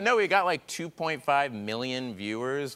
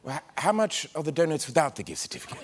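James Mason impression; 3 semitones higher than usual. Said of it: "I did him in my audition".

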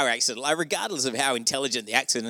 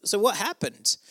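overly polite; 6 semitones higher than usual. Retold or imitated. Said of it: "in a more polite way".

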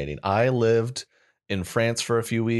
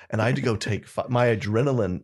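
ordinary delivery

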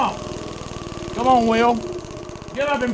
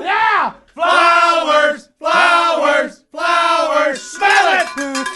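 excited hype shout; 9 semitones higher, much slower than usual. Acted. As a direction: sell the prize like a carnival barker. Host hypes flower prize.

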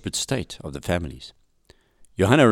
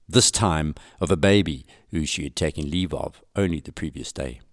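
ordinary delivery

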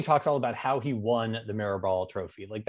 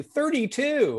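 amazed; 13 semitones higher, 4 dB louder, slower than usual. Real, not acted. Amazed at season count.

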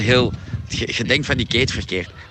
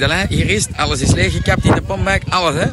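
raised, eager voice of a customer proudly reporting; excited mock customer; 6 semitones higher than usual. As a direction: play the thrilled customer listing what got thrown out.

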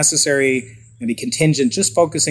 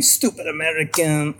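put-on Stupid American in a jokey Russian accent; mock Russian accent; 2 semitones higher, 4 dB quieter than usual. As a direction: mimic a sneering Russian tourist, lightly. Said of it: "like, a Russian accent".

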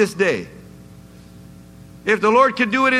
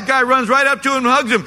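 ordinary delivery

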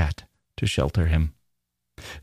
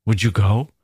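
stammered; 6 semitones higher than usual. A narrator's reading. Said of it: "I stammered".

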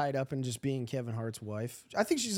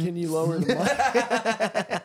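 laughing polite request; 4 dB louder, much slower than usual. Retold or imitated. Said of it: "Coulda just said".

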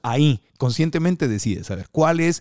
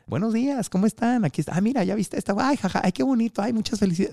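high gushing voice of a chatty friend; chatty WhatsApp gushing; 11 semitones higher than usual. Retold or imitated. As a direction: gush over group chat messages like a giddy friend. Acting out chatty WhatsApp messages. (voz aguda y empalagosa de chat de WhatsApp)

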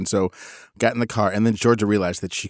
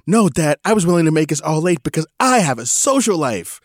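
put-on higher, louder whiny teenage protest; indignant teen daughter; 7 semitones higher, 5 dB louder than usual. Dad imitating his daughter talking back, higher and louder. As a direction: play the outraged teen throwing it back at Dad.

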